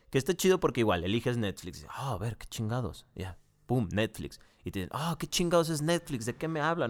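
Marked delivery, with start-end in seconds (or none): 4.9-6.9 higher, incredulous mock yell; baffled freakout. Programmer hears about Netflix, higher, baffled outburst. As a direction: play him confused by the word Netflix. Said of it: "se vuelve loco".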